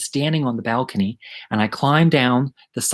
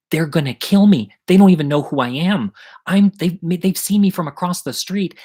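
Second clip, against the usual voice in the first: high, louder retold panic; panicked pleading; 6 semitones higher, 4 dB louder than usual. Hiding kid begs friend to go downstairs, panicked. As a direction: plead as if cornered.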